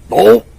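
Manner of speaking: sudden loud high yelped oh; Homer-style oh. Retold or imitated. The homeboy hears he's going to county, a loud high yelp. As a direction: blurt it like Homer Simpson. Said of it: "He straight did the homer".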